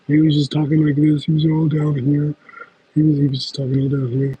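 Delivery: putting on a deep voice, lightly; mock a man's inauthentic low voice; imitating deep voice. Streamer imitating a dude they met, put-on deep voice. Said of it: "who had a really deep voice".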